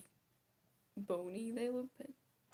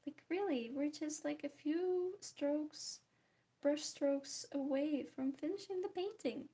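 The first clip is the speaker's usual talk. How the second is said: higher, louder self-imitation, pleading a case; mock insistence; 4 semitones higher, 5 dB louder, slower than usual. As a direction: insist loudly, hamming up your own excuse. Imitating own excuses to viewers.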